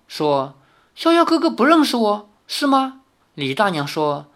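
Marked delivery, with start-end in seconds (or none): 0.9-3.0 put-on much higher, startled girl's voice; stunned disbelief. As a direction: caught off guard, asking in shock. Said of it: "赵灵儿一愣". (少女惊愕地发问)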